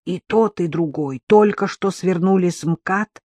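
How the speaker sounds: ordinary delivery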